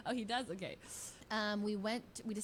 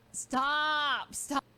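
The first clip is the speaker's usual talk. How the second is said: imitated tearful protest; 6 semitones higher, 8 dB louder than usual. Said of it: "tears are welling up in his eyes".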